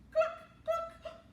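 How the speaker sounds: high clucking like a hen. Acted. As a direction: cluck like a chicken. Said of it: "All she could say was".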